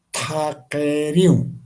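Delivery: Takeriu said slowly with each syllable distinct; slow emphasized articulation. Shown as a demonstration. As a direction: model Takeriu slowly for the students. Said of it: "enfatizar en las palabras que dificultan". (Takeriu lento y bien marcado)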